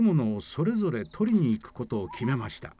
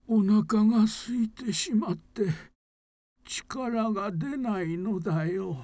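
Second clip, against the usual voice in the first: put-on weak old man; 7 semitones higher, slower than usual. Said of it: "小さな声で".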